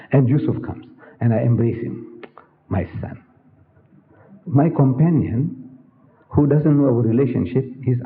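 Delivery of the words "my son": tender whisper. Retold or imitated. Embracing the boy fondly.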